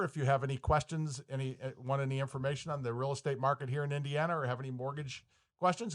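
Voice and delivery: ordinary talk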